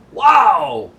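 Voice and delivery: very high, loud thrilled cry; delighted wow. Retold or imitated. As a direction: blown away by the taste. Mermaids taste omelets.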